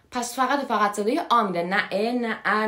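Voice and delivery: ordinary talk, nothing marked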